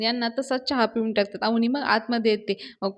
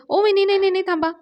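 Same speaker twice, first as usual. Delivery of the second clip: urgent stopping call; 9 semitones higher, 5 dB louder, faster than usual. Retold or imitated. Dhanya stops sister-in-law.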